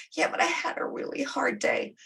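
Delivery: high whiny excuse; whiny teenager. Retold or imitated. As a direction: whine it like a teen dodging a chore. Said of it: "you got the whiny teenager".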